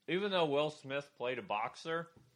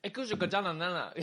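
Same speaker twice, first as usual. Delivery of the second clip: fast gibberish mock-Japanese, higher; 6 semitones higher, faster than usual. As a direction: imitate a Japanese broadcast in fake words. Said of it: "It was like".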